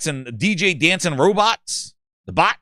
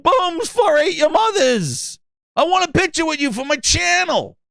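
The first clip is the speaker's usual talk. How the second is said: very high, hammed-up gamer voice; pushy channel fan; 10 semitones higher than usual. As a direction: pester the host in a high, overexcited pitch. Fan demands picture for his channel.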